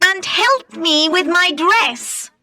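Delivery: high, demanding calls of her name; bossy ugly sisters. Acted. Ugly sisters calling Cinderella to fetch things, high and bossy. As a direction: shout orders at the servant girl, pitched high.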